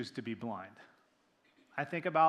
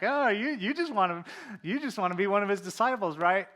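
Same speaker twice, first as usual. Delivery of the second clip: higher, louder mock-sweet ribbing; sarcastic teasing; 5 semitones higher, 10 dB louder than usual. Preacher voicing the healed man mocking the Pharisees, sarcastic. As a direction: drip sarcasm like a teasing sibling. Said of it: "I read the sarcasm in that".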